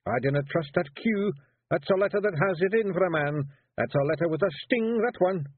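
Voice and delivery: high, Scottish Feegle warrior protesting; high Scots pixie voice wheedling his way out. A narrator's reading. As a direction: plead like a small nervous Scottish warrior. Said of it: "growled Rob".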